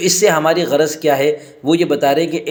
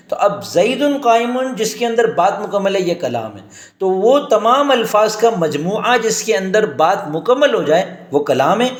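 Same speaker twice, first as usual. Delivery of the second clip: ordinary delivery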